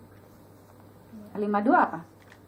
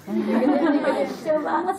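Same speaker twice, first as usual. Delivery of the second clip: laughing; much slower than usual. Real, not acted. Laughing at tiny print.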